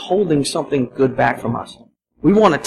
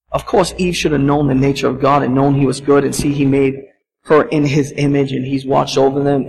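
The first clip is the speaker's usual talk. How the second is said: ordinary talk, nothing marked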